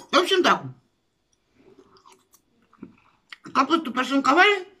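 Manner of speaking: chewing while talking; eating cabbage stew; mouth full. Real, not acted. Seen 0: Bite taken at -5; jaw still working, food in mouth while speaking.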